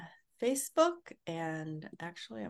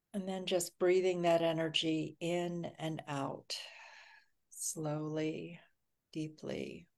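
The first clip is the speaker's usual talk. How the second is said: guided meditation. Acted. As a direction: ease everyone into calm and safety. Leads a grounding meditation.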